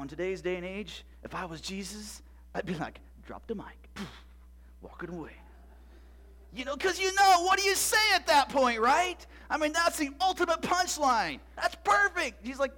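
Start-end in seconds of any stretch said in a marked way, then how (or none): 3.3-5.4 low, soft mic-drop aside; cool understatement. Acted. Preacher imagining Jesus walking off triumphant, understated. 6.5-12.5 high, loud enthusiasm; excited. Real, not acted. Preacher marvelling at the ultimate punchline, excited.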